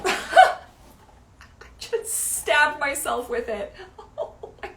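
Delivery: high, breaking into laughter; laughing at herself. Real, not acted. Just stabbed herself by accident, laughing at it. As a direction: squeeze the words out through real laughter.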